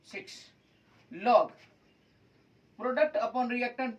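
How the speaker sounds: slow, word-by-word formula dictation; dictating a formula to the class. Shown as a demonstration. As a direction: spell the formula out slowly for students to write.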